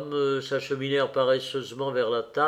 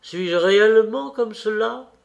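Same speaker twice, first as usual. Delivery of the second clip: higher, louder, astonished question; crying out; 6 semitones higher, 5 dB louder than usual. A narrator's reading. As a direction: call out the question with raised pitch. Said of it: "cria l'original du portrait". (question lancée plus haut et plus fort)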